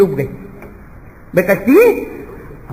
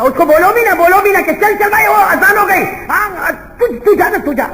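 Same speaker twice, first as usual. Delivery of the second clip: mock dialogue; 11 semitones higher, 3 dB louder than usual. Mimicking lazy man at azan.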